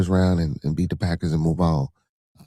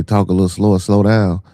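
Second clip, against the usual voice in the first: louder read comment; 7 dB louder than usual. Retold or imitated. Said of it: "You say".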